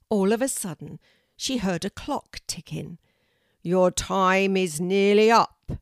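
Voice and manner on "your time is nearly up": loud, slow booming call, "Your time is nearly up"; bellowing oak tree. A narrator's reading. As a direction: boom it out like an ancient tree. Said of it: "bellowed the teacher of the oak tree".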